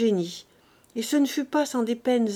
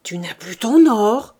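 loud, drawn-out outburst of disbelief; stunned, slow shout; shocked cry; 11 dB louder, slower than usual. A narrator's reading. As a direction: cry out as if jolted by cannon fire. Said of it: "s'écria Grandet".

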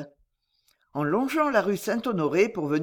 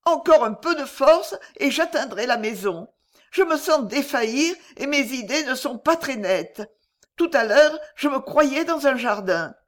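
put-on higher, pleading voice of a frightened girl; distraught young girl; 6 semitones higher, 3 dB louder than usual. A narrator's reading. Lydie tells Corentin of her ordeal, higher and pleading. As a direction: voice the shamed young girl, near tears.

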